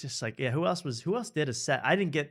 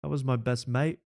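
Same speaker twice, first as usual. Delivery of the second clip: [name] impression; 3 semitones higher than usual. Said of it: "[name] goes".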